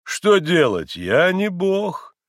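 loud, slow, drawn-out self-important doctor; pompous offhand verdict, pitched high; careless, imposing. A narrator's reading. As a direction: pronounce it with grand indifference. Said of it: "Небрежным, хотя и привычно внушительным голосом".

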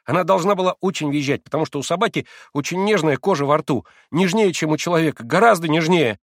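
high, breathless-with-delight explaining; frenzied, delighted exclaiming. A narrator's reading. Boy explaining to his sick friend, elated and insistent. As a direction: rattle out the proof with beaming excitement. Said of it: "восклицал неистово Коля с разгоревшимся и сияющим от восторга лицом".

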